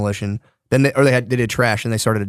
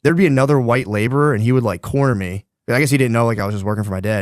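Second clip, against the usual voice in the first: ordinary delivery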